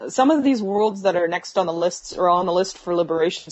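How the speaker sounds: ordinary delivery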